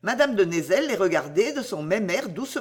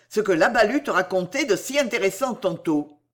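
ordinary delivery, nothing marked

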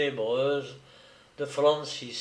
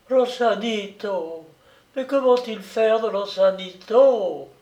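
delirious fear; 7 semitones higher, 4 dB louder than usual. Acted. Delirious woman in terror.

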